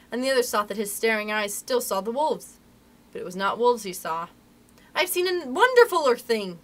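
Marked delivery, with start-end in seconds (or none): 4.9-6.6 higher, louder voice full of wonder; excited cry. A narrator's reading. Nibs telling the boys his sighting, excited cry. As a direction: announce a wonder, high and eager. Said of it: "he cried".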